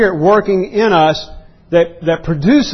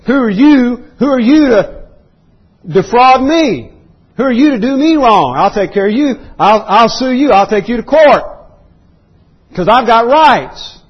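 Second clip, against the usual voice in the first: indignant "I've got rights" voice; 7 semitones higher than usual. Retold or imitated. To a brother who wronged him, an indignant entitled protest. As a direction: play the entitled believer insisting on his rights. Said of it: "I've got rights" movement like we have today".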